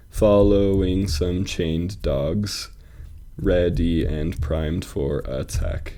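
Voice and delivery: slow, measured scansion; metrical, deliberate pacing; syllable-counting reading. Shown as a demonstration. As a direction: draw out each syllable so it can be counted.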